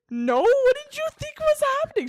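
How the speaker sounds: very high, loud incredulous denial; mock indignant. Answering Sierra's teasing, high and incredulous. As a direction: sound scandalized and innocent.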